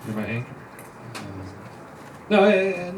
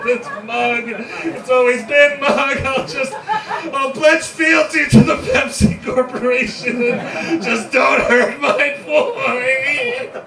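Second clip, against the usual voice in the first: hysterical confession; 12 semitones higher, slower than usual. Acted. Prisoner confessing in anguish.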